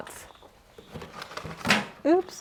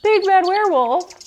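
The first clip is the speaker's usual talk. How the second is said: excited name callout; 8 semitones higher than usual. Real, not acted. Reads a viewer's name aloud.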